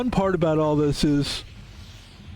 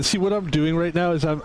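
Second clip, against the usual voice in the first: usual speaking voice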